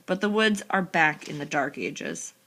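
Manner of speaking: ordinary delivery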